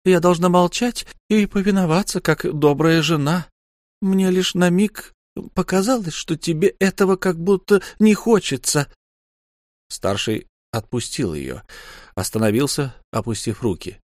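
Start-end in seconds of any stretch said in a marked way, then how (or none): 0.1-8.9 high, faster, teasing woman's voice; wife mocking her husband in a high teasing voice; Lyu mocking. A narrator's reading. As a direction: needle him with high false indignation. Lyu's mock-obedient speech.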